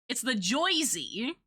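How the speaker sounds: slow, put-on New Jersey accent. Shown as a demonstration. Showing chat the well-known Jersey sound, in a Jersey accent. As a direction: draw it out like a Jersey Shore "Joisey". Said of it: "It's more like that".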